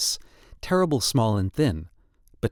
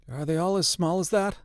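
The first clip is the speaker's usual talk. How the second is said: hoarse; 6 semitones higher than usual. A narrator's reading. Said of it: "he asked hoarsely".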